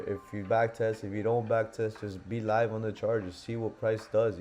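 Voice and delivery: ordinary talk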